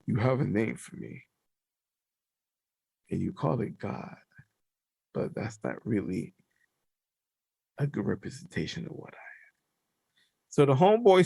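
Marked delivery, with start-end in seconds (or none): 0.0-9.5 low, soft, halting godlike voice; measured and lower, weighing each phrase; changed voice of God. Acted. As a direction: speak as God, hushed, with long pauses. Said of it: "I'ma change my voice though".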